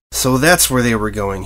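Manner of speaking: usual speaking voice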